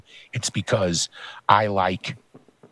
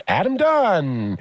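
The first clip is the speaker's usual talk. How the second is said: high, slow ballpark announcer call; baseball announcer; 12 semitones higher than usual. Retold or imitated. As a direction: boom out the lineup like a stadium PA. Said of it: "sounded like a baseball announcer announcing".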